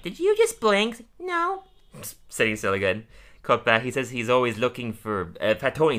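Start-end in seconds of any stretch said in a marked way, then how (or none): 0.0-1.1 put-on high, slow little-boy voice; Rod's voice. Rod asks Todd during the stillness game, high child voice. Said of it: "And Rod's like". 1.2-1.6 a tiny high squeak of a no; Todd's answer. Retold or imitated. Todd answers his brother, very high small voice. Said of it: "And Rod's like".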